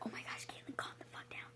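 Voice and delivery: soft urgent whisper, nearly inaudible; hushed scolding whisper. Retold or imitated. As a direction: friends hissing at her to calm down. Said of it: "It's like".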